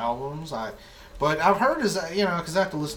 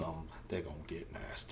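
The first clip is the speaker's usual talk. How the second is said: sexy deep voice; 8 semitones lower, 12 dB quieter than usual. Pretend. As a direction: purr it like a soul singer.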